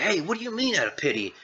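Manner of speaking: quick, high-pitched protest; mock indignant. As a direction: imitate someone taken aback by an insult. Jack objects to the insult.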